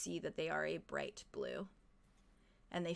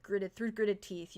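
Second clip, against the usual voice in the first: normal talking voice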